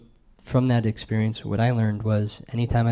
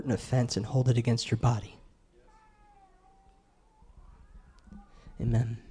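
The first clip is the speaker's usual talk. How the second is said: prayer. Acted. Leads a prayer.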